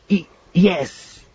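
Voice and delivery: slow, stammered yes, lightly acted; strained, cracking reply. A narrator's reading. Surprised Mallory answering a proposal, strained stammering voice. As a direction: barely get the word out, mildly overcome. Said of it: "上ずった声でかろうじて返事をした".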